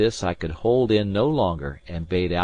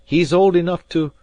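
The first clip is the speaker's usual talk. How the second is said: louder, higher ominous emphasis; grim loud hint; 11 semitones higher, 9 dB louder than usual. A narrator's reading. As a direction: warn darkly.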